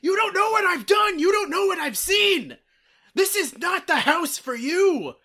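high, loud battle yell; screaming. Retold or imitated. Man re-enacting his rant at invading flies, full-on screaming. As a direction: bellow it like a raging Viking. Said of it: "I just start screaming at them".

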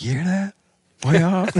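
high, hushed mock of eavesdropping hunters; small, high voice, as if straining to hear; imitating listening hunters. Host mimics hunters hearing a distant gobble, high and hushed. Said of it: "You can feel the people being like".

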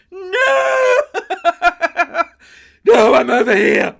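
very loud, high call across a car park; laughing while voicing the driver calling her over. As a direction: shout to be heard far off. Real Uber driver calling out.

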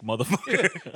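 high, laughing tough-guy brag; laughing boast. Pretend. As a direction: crow it through a laugh. Boasting new Isaac, laughing.

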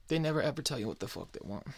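ordinary delivery

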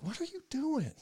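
high, soft scolding of himself; self-scolding. Retold or imitated. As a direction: asks himself in a high hushed voice. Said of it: "and I'm like".

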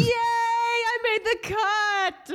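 slow, high, gleeful squeal; excited. Real, not acted. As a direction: cheer it like a winner. Celebrating making the cut.

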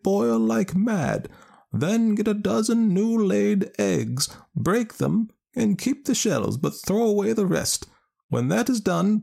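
a knowing village wise woman; old woman voice. Acted. Ellen Leah gives instructions.

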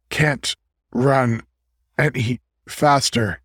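winded, broken by pauses; acted panting. A narrator's reading. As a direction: struggle to get the words out, out of breath. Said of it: "he panted".

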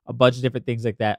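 ordinary delivery

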